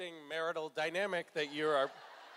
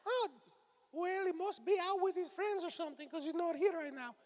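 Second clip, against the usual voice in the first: much higher puppet voice calling out, rising louder on each Dad; 11 semitones higher, 4 dB louder, slower than usual. Acted. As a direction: call for the missing dad in the puppet voice. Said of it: "the dad is always like".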